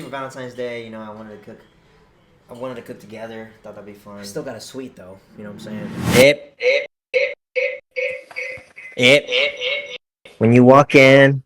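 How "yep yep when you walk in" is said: loud room tour. Real, not acted. Room tour, much louder.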